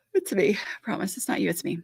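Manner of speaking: soft whisper of reassurance; hushed, sheepish reassurance; whispered apology. Real, not acted. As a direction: whisper it like a private aside. Apologizing to viewers after yawning.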